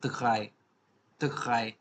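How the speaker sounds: दगाई with a throaty German R after D; German drei. Shown as a demonstration. Teacher showing learners three, दगाई with throaty R. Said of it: "इसको हम बोलते हैं".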